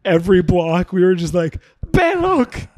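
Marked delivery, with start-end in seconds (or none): 1.9-2.7 high shouted street directions; calling out turns at a cabbie; drunk yelling. Retold or imitated. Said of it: "All yelling different stuff".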